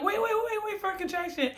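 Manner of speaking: imitating the nurses stopping her, high but hushed; urgent staff warning. The nurses stopping her from pushing too early, in a high, soft mock warning. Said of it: "They're like".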